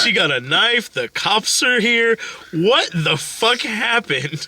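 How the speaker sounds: high, drawn-out demand to know; exasperated. Retold or imitated. Wife finds cops and a knife outside, exasperated.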